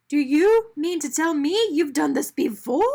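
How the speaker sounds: can't believe what he hears; horrified. A narrator's reading. Arnie horrified question.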